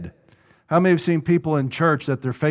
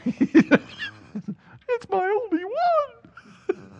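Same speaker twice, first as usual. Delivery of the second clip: very high, very slow squeaky whimper; mock whine; much slower than usual. Husband protests to wife over his last twenty, whiny squeak. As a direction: squeak it out like a pitiful miser.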